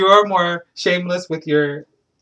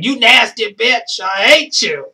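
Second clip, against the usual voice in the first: slow, loud, higher mock insult; mock angry callout; 6 semitones higher, 8 dB louder, slower than usual. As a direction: hurl it like a catty insult. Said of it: "calling somebody out saying".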